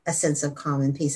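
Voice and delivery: ordinary talk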